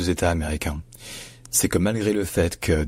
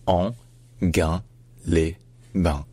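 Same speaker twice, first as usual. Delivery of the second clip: Anguin-les-Bains very slowly in four separate syllables; slow split Anguin-les-Bains, hard g; much slower than usual. Shown as a demonstration. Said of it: "il faut la lire comme le mot gain".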